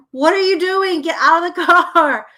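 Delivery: loud, high scolding; hammed-up exasperated parent yelling; exaggerated irritation. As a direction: scold a dawdling kid at full volume. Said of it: "I'm exaggerating 'cause I'm, like, super irritated".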